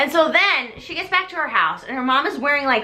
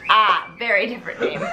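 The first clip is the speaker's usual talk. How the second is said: normal talking voice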